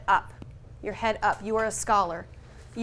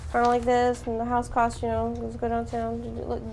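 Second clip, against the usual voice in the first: hesitant mumble, fading quieter; unconfident mumbling; 4 dB quieter than usual. Shown as a demonstration. Unsure agent trying to sell a home, mumbled and unconfident. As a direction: trail off like you doubt yourself. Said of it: "if you're like this".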